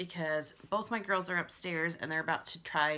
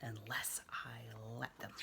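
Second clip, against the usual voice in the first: whispered, lower, sinister; menacing whisper; 7 semitones lower, 12 dB quieter than usual. Acted. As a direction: whisper it like a lurking tiger. Menacing tiger threat.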